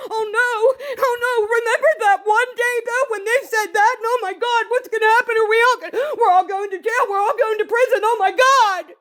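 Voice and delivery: high, frantic, fast mocking panic. Mocking the friend group in a high frantic panic. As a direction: ham up the friends' hysteria.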